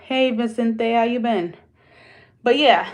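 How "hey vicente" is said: ordinary talk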